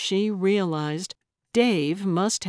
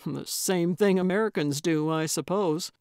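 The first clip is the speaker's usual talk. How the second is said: usual speaking voice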